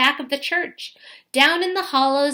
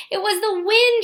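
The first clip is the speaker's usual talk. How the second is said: high, bright exclamation of delight; thrilled realization; 9 semitones higher, 5 dB louder than usual. A narrator's reading. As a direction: cry out in joyful discovery. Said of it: "knelt in delight".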